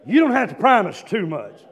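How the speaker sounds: usual speaking voice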